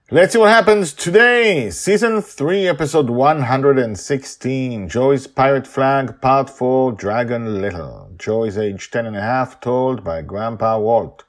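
high, loud announcer read; episode title announcement. Acted. Host announcing the episode to listeners, announcer voice.

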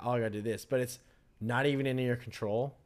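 ordinary delivery

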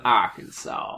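put-on drawled Arkansas accent. Co-host finishing the spelling challenge, Arkansas accent. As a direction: say it like an Arkansas local. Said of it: "That's a really good accent".

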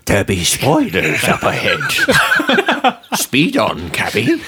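put-on spooky old coachman. Old-timey warning to a cabby.

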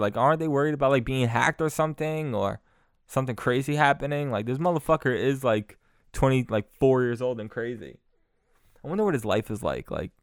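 ordinary talk

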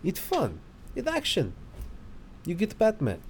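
put-on Russian accent, short flat phrases. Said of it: "That's a better Russian accent".

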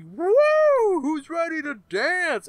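high, loud hype-man whoop; hype man shout. Retold or imitated. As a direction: pump up the crowd, way up high. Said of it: "is like".

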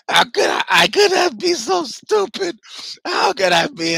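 loud, high wailing dismay at his own blunder; anguished self-reproach. Retold or imitated. Telling a friend he missed his idol, half-crying loud wail. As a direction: groan out the horror of not recognizing his hero. Realizing it was Bruce Campbell.

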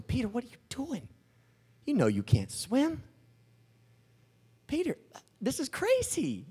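high, hushed voice of disbelief; incredulous onlookers. Acted. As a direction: question a friend's sanity, pitch climbing. Others in boat question Peter.

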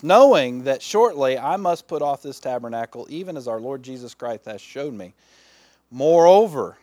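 emphatic preacher's reading, ringing out on the openings; scripture reading. Acted. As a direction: read it out to the congregation, punching key words. Reads the scripture aloud.

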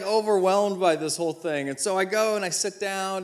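ordinary talk

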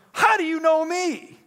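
high, loud astonished question; Nathaniel, stunned that a stranger knows him; amazed Nathaniel. Retold or imitated. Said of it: "Nathaniel says".

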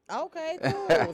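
high, slow, laughing okay cool. Real, not acted. Host laughing at the page name, high and slow. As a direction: laugh it out while agreeing.

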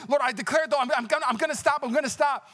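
high, insistent pleading; mock desperate vow. Teen promising the Lord he will stop, high mock-desperate plea. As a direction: promise frantically, pitched well up.

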